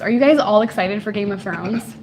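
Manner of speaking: ordinary talk, nothing marked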